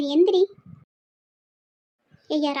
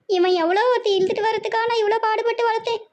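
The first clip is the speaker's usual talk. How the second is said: high, rising wail of betrayal; mother's high-pitched tearful complaint; anguished lament; 5 semitones higher than usual. Acted. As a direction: wail it to the relatives.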